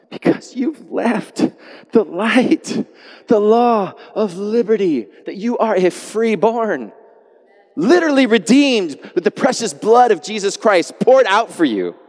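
high, ringing preacher's cry; preaching at full force. Acted. Preacher to congregation, soaring full-force preaching. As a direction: lift it up like a revival preacher.

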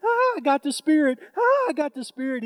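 high, loud, joyful exclaim; a mother shouting that she got the Spirit; ecstatic cry. Retold or imitated. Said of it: "She's laughing and going".

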